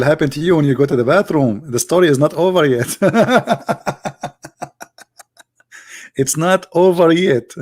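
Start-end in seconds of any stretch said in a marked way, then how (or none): 3.0-4.8 long high cackling laugh, tapering off; real laughter. Real, not acted. Joker amused by his own bathroom warning, real high laughing. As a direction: crack up in high, rapid bursts that die away.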